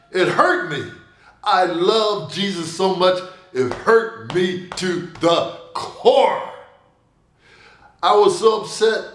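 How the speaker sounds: ordinary delivery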